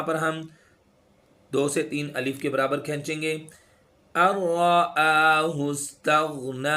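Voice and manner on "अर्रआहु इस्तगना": slow, melodic tajweed chant; Quran recitation. Shown as a demonstration. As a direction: recite slowly, with each sound drawn out.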